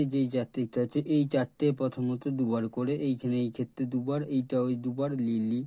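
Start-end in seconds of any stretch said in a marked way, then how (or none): none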